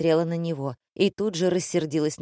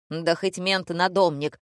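plain reading voice

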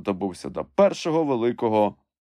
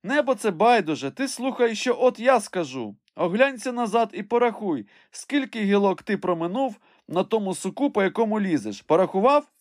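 high, raised instructing voice; impatient master calling up; 12 semitones higher than usual. A narrator's reading. Master tells climber to count the branches, high urgent call. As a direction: call orders up to someone far above. Legrand shouts instructions up the tree.

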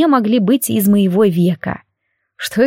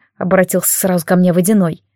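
plain reading voice

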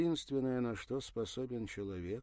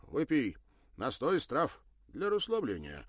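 insistent offer; 7 semitones higher, 8 dB louder than usual. Acted. Offers herbal drink loudly.

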